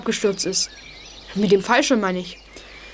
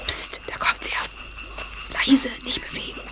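slow, softer hushed warning; urgent whisper; 5 dB quieter, slower than usual. Acted. Boy warns friends someone is coming, urgent whisper. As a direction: freeze them with a whisper. Someone approaches hidden boys.

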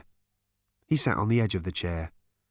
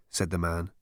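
plain reading voice

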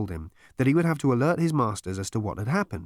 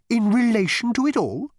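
astonished Gargravar; 12 semitones higher, 5 dB louder than usual. A narrator's reading. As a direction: ask in disbelief. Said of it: "said Gargravar".

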